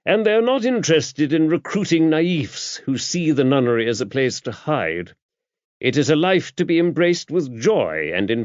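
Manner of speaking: put-on an older churchman explaining with brisk authority; bishop.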